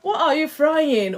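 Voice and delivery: loud and high, shouting toward the kitchen; calling out. Real, not acted. Streamer calls to the boy frying in the kitchen, loud and high. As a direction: yell the question through the house.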